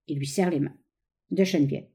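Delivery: ordinary delivery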